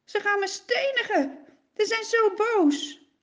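begging for help; anxious prayer. Acted. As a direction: Moses praying in fear of stoning. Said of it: "bidt Mozes".

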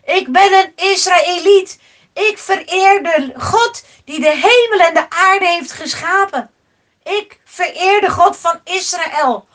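loud declaration. A narrator's reading. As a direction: proclaim your faith out loud. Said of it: "riep hij". (luid en nadrukkelijk verklaren)

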